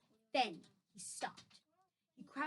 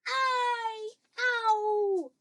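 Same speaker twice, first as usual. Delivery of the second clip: high, loud yelps of pain; roared cries; 10 semitones higher, 10 dB louder than usual. Acted. Giant in the grip of a nightmare, roaring cries. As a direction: draw out each howl. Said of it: "roared the flesh lump eater".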